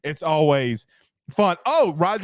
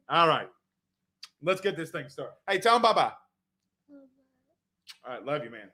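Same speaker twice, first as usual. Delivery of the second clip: ordinary talk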